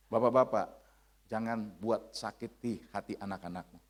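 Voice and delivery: ordinary talk, nothing marked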